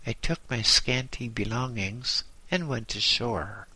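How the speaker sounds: usual speaking voice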